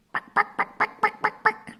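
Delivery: high, clipped hen clucks; chicken clucking. Pretend. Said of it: "what animal that is".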